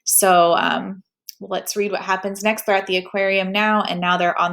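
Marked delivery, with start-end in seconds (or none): none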